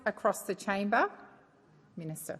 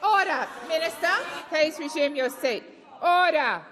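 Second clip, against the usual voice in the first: raised, higher calls of order; shouting order order; 4 semitones higher, 4 dB louder than usual. Real, not acted. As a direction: call the chamber to order loudly. President calls chamber to order.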